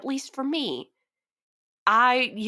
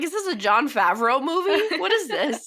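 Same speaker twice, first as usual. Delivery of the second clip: laughing; 6 semitones higher, 4 dB louder than usual. Retold or imitated. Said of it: "I'm like".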